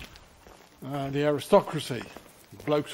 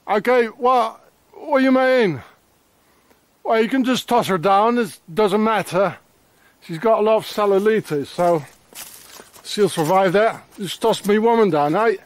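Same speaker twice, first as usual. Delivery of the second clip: mock rant; 6 semitones higher, 4 dB louder than usual.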